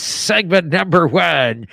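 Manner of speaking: booming slow announcer voice; fighting game announcer. Acted. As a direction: call it out like an arena announcer. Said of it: "this announcer voice".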